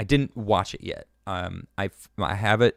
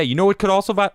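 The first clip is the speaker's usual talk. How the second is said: usual speaking voice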